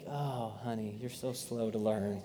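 lightly voiced wife gently teasing. Wife teasing her slow husband, soft and low. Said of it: "And she's like".

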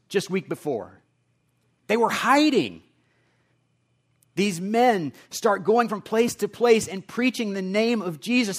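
full-force sermon climax; impassioned preaching. Real, not acted. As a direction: drive it home to the congregation.

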